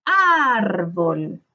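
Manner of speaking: load the voice onto the ÁR of "Árbol"; drawn-out ÁR in "Árbol", falling away on bol; stressed syllable stretched. Shown as a demonstration. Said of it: "descubrimos la sílaba tónica".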